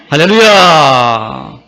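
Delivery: long, loud, slow hallelujah call; drawn-out hallelujah. Real, not acted. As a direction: stretch it out to rally the church. Rally call after blessing. (길게 끌며 크게 외치는 할렐루야)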